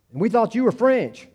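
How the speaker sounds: high-pitched retold kid voice; little kids. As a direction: insist like a puzzled little kid. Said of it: "the little kids were saying".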